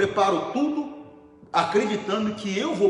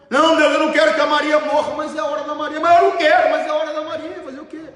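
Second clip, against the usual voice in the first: high, loud pleading cry to God; anguished prayer; 10 semitones higher, 7 dB louder, faster than usual. Acted. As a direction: beg God loudly not to take her. Pastor acts out anguished prayer. (súplica alta e angustiada)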